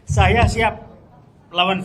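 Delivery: "Saya siap" shouted loud and high; a loud, defiant call to battle. Real, not acted. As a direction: throw "Saya siap" out as a fighting pledge. Declares he is ready to fight.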